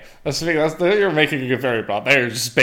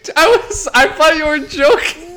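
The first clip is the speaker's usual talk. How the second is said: laughing disbelief; 6 dB louder than usual. Real, not acted.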